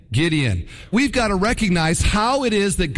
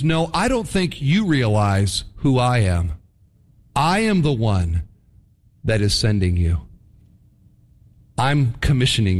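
lower, firm, authoritative reply; voice of God; 6 semitones lower than usual. Retold or imitated. God answering Gideon, lower and commanding. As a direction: answer with calm divine authority. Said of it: "what God is saying is".

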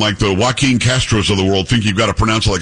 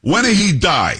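put-on higher, blunt Italian-accented question; thick Italian accent; 7 semitones higher than usual. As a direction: imitate a bold Italian woman's accent. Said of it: "with a thick, uh, um, Italian accent".

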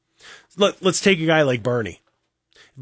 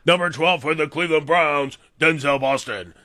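booming arena PA intro voice; stadium announcer. Retold or imitated. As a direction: call the name like the stadium announcer. Mimics stadium PA announcing player.

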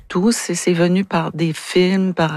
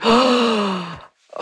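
gasp of shock; 5 semitones higher than usual. Retold or imitated. Reliving the shock on set.

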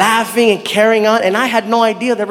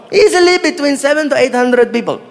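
put-on higher-pitched Saudi-accented reply; accented driver; 5 semitones higher than usual. Driver estimating the crowd for the comic, accented.

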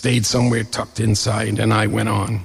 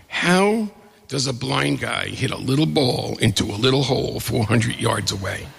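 ordinary talk, nothing marked

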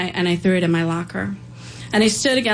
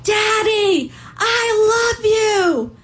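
put-on high, drawn-out child's cry through the glass; child's scream; much slower than usual. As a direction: call out to daddy like a small girl, pitch way up and slow. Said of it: "and she screams".

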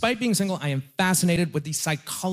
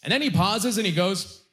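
ordinary talk

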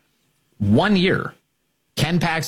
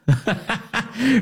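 mocking laugh; 8 semitones higher than usual. Real, not acted. Laughs at Cornyn's own phrase.

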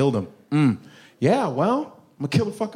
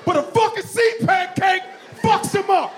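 laughing shout; 14 semitones higher than usual. Real, not acted. Comic ranting to the crowd, laughing shout. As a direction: shout it through laughter at the news.

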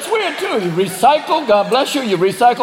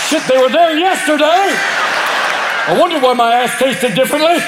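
shocked dog; 6 semitones higher than usual. Acted. Dog realizes loss.

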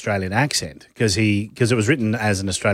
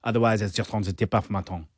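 French accent; 6 dB quieter than usual. Shown as a demonstration. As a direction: slip into an understated French accent.

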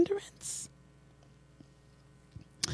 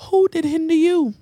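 long high "Who", then falling through the question; emphatic WHO; 7 semitones higher than usual. Shown as a demonstration. Preacher demonstrating the scripture question, emphatic high WHO. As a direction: hit "Who" high and hold it before "did hinder you". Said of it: "You have to say who?".

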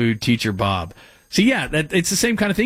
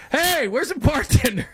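high, drawn-out imitation of calling customers; mock yelling; 14 semitones higher than usual. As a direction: mimic impatient customers calling out, pitched way up. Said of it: "people were yelling at me".

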